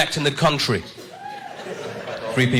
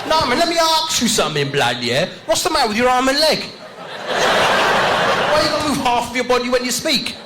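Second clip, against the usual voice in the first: high, swaggering street slang; mock London roadman; roadman kid; 10 semitones higher than usual.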